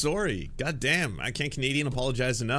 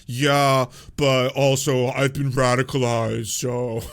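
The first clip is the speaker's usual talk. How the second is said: far louder, slow, goofy shrugging excuse; mock radicalized guy; 3 semitones higher, 10 dB louder, slower than usual. Mocking the husband's excuse, loud goofy shrug. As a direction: shrug it off like a dopey convert.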